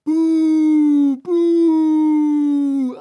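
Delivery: long slow disapproving boo; drawn-out booing. A narrator's reading. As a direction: boo him down. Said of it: "gemeinsamen Buh".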